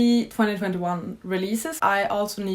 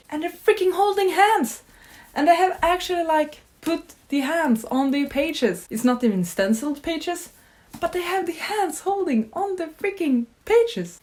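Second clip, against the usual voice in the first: thrilled gushing; 9 semitones higher than usual. Real, not acted. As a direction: squeal it out, can't believe how pretty it is. Gushing over hidden book details.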